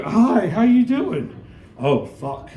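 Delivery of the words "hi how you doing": high, slow, sing-song hello; drawn-out sugary greeting to strangers; mock cheery greeting. Greeting the little people from a rooftop, slow and high mock-friendly.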